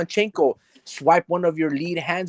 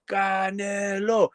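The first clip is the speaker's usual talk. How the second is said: slow, higher drawn-out call of the name; 4 semitones higher, 3 dB quieter, much slower than usual. Retold or imitated. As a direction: stretch the name out slowly, pleading. Calling out Canelo in disbelief.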